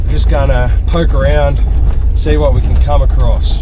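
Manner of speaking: ordinary delivery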